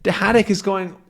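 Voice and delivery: ordinary delivery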